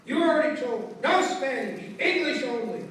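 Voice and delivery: loud, forceful imitated scolding; teacher's yell. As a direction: yell it as an angry playground teacher. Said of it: "and she yells".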